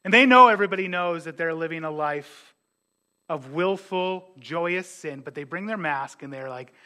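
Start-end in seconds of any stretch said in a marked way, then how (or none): none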